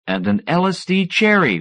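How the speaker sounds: plain reading voice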